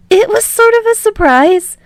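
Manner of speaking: put-on high, loud boyish voice; bright nervous young hero; Midoriya voice. A narrator's reading.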